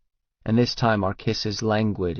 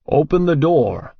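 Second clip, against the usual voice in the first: higher, louder barked command; commanding Russian man; 4 semitones higher, 5 dB louder than usual. A narrator's reading. Petrovich at the hotel door, a loud commanding order. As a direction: order them to open up. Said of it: "a deep voice commands in Russian".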